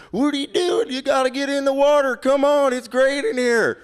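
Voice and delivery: Russell. Retold or imitated. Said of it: "he says".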